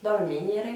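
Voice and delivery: dormire said slowly in Italian, rising at the end; teacher's second take of dormire; Italian model word. Shown as a demonstration. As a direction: repeat dormire, Italian r and stress on mi. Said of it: "je vous le montre ici".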